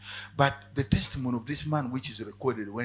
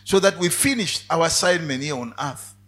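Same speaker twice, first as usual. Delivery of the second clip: closing prayer; 3 semitones lower, 7 dB louder than usual. Acted. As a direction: pray for the congregation, building. Preacher leads a prayer.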